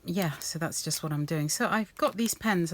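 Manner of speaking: ordinary delivery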